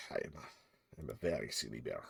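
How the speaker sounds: low, soft silly bear; a low, soft goofy bear. Acted.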